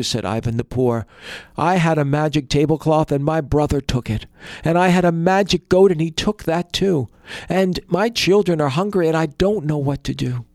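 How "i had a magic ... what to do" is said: high, loud desperate plea; desperate pleading. A narrator's reading. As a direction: beg for help, close to tears. Ivan pleads, children hungry.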